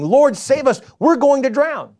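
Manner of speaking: loud, high cry for help; the disciples calling out to Jesus over the storm; disciples shouting. Retold or imitated. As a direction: shout it like men in a sinking boat.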